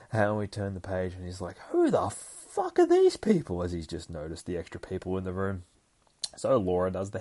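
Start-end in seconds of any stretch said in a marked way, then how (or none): 1.7-3.6 very high, loud, slow disbelief; drawn-out incredulous outburst; outraged surprise. Retold or imitated. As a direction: shriek the question in mock shock. Acted shock at strangers in room.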